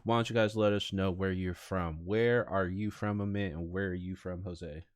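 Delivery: normal talking voice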